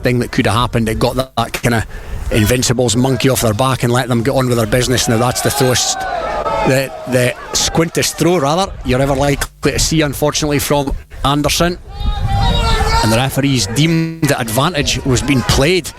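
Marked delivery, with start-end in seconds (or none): none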